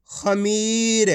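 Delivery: खमीर very slow, with the ee of मी held; drawn-out reading. Shown as a demonstration. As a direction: stretch out खमीर for learners. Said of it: "खींच खींच के पढ़ना है".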